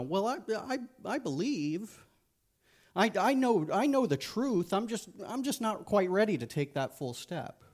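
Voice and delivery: high, hedging believer voice; mock the half-committed believer; hesitant fence sitter. Preacher mimics a fence sitter, high and hedging. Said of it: "They say things like".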